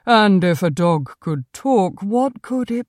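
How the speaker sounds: lightly voiced sceptical cat; dismissive Conrad the cat. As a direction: question it as a disdainful talking cat. Cat dismisses dogs' talk.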